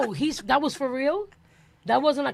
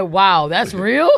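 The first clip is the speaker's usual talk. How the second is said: quoting listener's disbelief; 3 semitones lower, 8 dB louder, much slower than usual. Retold or imitated. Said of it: "Glenn said".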